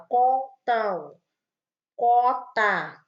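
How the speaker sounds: blocked-nose demo for the class; nose pinched shut. Shown as a demonstration. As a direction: pinch the nose and say contam, cota. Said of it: "a gente fecha o nariz e fala".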